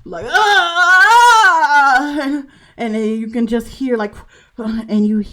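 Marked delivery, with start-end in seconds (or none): 0.3-2.2 high loud pained scream; woman's scream. Acted. Woman in labour behind the door, loud high scream. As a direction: cry out in labour pain. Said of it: "you hear a woman, like".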